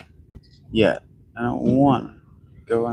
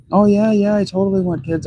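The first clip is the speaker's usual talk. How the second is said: mock eager girlfriend; 9 semitones higher than usual. Mocking ex-partner's promise.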